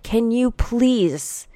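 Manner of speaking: normal talking voice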